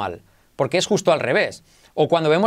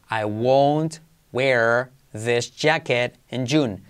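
very slow, each word separated; slow practice sentence; 5 semitones lower, much slower than usual. Shown as a demonstration. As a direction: dictate it word by word. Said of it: "Una frase que vamos a hacer". (frase lenta, palabra por palabra)

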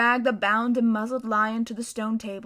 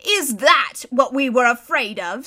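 scoffing creature; 7 dB louder than usual. Acted.

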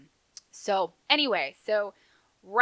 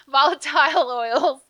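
laughing; 7 semitones higher, 11 dB louder than usual. Real, not acted. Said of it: "so I can't say that word".

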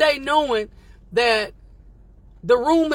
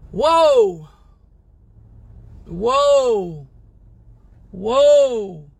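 awed whoa; 5 dB louder, much slower than usual. Real, not acted. Reacting to own line.

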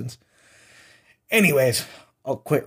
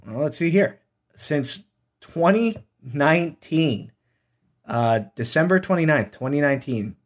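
normal talking voice

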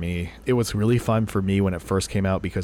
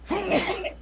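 sneezing. Real, not acted. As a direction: sneeze right into the mic. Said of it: "Finally came, I apologize".

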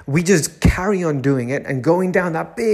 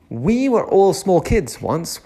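normal talking voice

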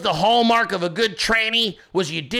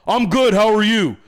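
louder, plain masculine reply; unlightened man's voice; 5 dB louder than usual. Retold or imitated. Worker in makeup answering his greeting, voice not lightened. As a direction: answer loudly without lightening the voice. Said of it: "You didn't even lighten the voice".

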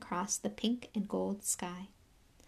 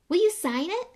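put-on high, loud eager boy asking; 10 semitones higher, 8 dB louder than usual. A narrator's reading. Colin asks Harry for an autograph, high and loud. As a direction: beg for it with bright hope. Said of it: "said Colin eagerly".

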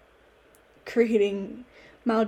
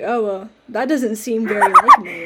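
laughing through the quote; 12 dB louder than usual. Retold or imitated. Mom's calm reply retold.

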